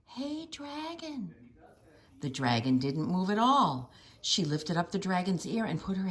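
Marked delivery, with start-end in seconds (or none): 0.1-1.3 slow, softer whispered call. A narrator's reading. Princess testing the sleeping dragon, whispering. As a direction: check if he's asleep. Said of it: "whispered very softly".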